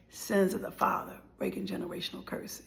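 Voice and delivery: soft, breathy hushed whisper; whispered refrain. Real, not acted. Closing on family sin for viewers, a whisper. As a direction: whisper it like a solemn truth.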